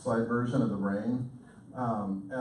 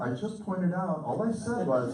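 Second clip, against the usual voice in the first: mildly raised, insistent self-defence; defensive tone; 7 semitones higher than usual. Retold or imitated. Husband defending himself to his upset wife, edgy. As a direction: protest innocence with an edge of tone. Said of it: "'Cause you heard the tone".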